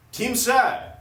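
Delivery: high loud caveman-style jock; dumb jock voice. Acted. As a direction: read it like a dim athlete. Athletes' statement read dumbly.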